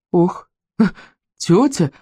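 slow, gasping, half-laughing; breathless exclamation. A narrator's reading. Startled boy answering the saleswoman, breathless. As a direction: gasp it out with a laugh. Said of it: "воскликнул Вовка, тоже еле переводя дыхание".

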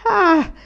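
a high, loud mock-startled "Ah!"; old servant's hammed-up cry of alarm; comic frightened cry. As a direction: yelp as if the boy is really about to jump. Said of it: "gridò la vecchia, comicamente spaventata".

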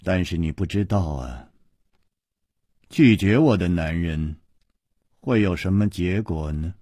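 low, measured, chilly warning; icy, understated menace; coldly. A narrator's reading. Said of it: "冷冷地说".

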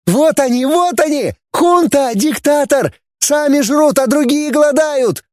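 put-on very high, screechy girl's voice; shrieking. A narrator's reading. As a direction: screech accusations at the top of your range. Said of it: "Девица заверещала".

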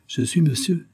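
put-on high, dignified old man; old notary answering. A narrator's reading. Notary replying to the commissioner, dignified.

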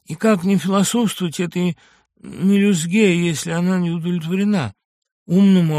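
scornful, heated tirade; a lightly voiced mocking madman; bitter ranting patient. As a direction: sneer at the doctor's fate, rising to a cry. Ivan Dmitrich ranting at doctor.

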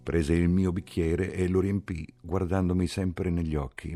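ordinary delivery, nothing marked

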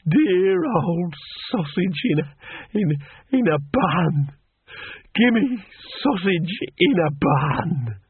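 slow, broken, weepy pleading; tearful blubbering. A narrator's reading. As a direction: blubber it out, stumbling on the words. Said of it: "burst into tears".

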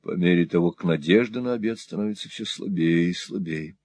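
ordinary delivery

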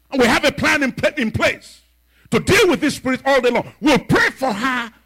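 high, loud preaching build; preaching fervor. Real, not acted. Preacher rallying the congregation, loud and high. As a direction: preach it up to the rafters.

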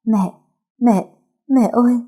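calling for mother in panic; panicked cries. A narrator's reading. Nhiên hearing her mother is dying, panicked cry. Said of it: "miệng không ngừng lẩm bẩm".

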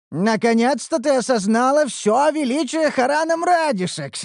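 high, self-satisfied proclamation; pleased boast. Acted. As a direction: preen over your own greatness. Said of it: "довольно проговорил".

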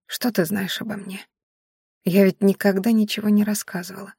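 soft, weakened, breath-saving murmur; barely audible whisper. A narrator's reading. Dying Maiari urges Viedash to forget her, faint whisper. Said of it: "едва слышно прошептала Маяри".

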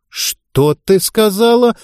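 high, slow outraged cry; hammed-up indignant yell, stretched out; indignant shout. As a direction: fling it back, scandalised. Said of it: "возмущённо выкрикнула оппонентка".

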